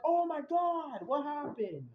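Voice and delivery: high, slow, stunned "Oh my God"; shocked scream. Retold or imitated. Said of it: "I was screaming".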